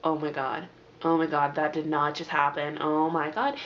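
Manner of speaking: low, retold shock; stunned disbelief. Retelling shock at last paragraph.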